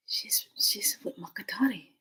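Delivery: hushed, breath-only murmur; imitated awed whisper. Onlookers point at the necklace, imitated whisper. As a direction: whisper it like onlookers passing word along.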